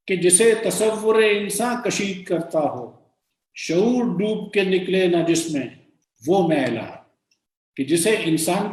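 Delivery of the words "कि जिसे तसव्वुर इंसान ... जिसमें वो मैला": measured verse, starting high and falling at the close; poem recitation. Acted. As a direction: recite it with held syllables.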